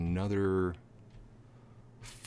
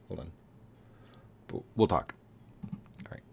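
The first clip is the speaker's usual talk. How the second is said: ordinary talk, nothing marked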